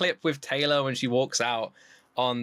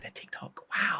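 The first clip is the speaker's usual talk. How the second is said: hushed, whispered amazement; very soft, breath-only wow; awed whisper; 6 dB quieter than usual. Retold or imitated. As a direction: whisper it as if awestruck. Retells awe at their channel, hushed.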